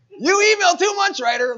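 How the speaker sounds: high, loud mock yell of an irritated subscriber; hammed-up whiny customer yelling about too many emails; mock complainer shout. Voicing an annoyed email subscriber.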